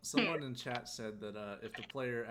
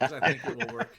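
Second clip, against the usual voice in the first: louder, laughing as she pushes the point; loud laughing insistence; 3 semitones higher, 13 dB louder than usual. Acted. Player insisting the unbuttoning will work, loud and laughing. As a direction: belt it out through a laugh.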